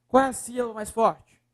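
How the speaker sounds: ordinary talk, nothing marked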